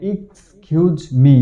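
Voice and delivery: slow, careful "এক্সকিউজ মি" with a British accent; British pronunciation demo. Shown as a demonstration. Teacher slowly models the phrase for students, careful British pronunciation. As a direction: spell out "এক্সকিউজ মি" slowly as "ikskiuz mi". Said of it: "সঠিক উচ্চারণ British অ্যাকসেন্টে".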